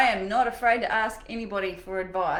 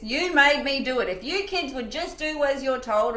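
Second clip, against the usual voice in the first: higher, exasperated parent scolding; acted nagging complaint; mock blaming; 5 semitones higher, 5 dB louder than usual. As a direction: put the blame on them, hammed-up. Acts out blaming the kids.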